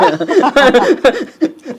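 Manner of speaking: high-pitched burst of laughter. Real, not acted. Risa propia tras confesar su gusto.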